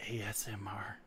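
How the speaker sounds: very soft, low mock-ASMR murmur; creepy ASMR voice. Teasing a friend about ASMR videos, hushed and creepy. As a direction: drop right down into a hushed ASMR voice. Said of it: "Okay, stop that. You're creeping me out".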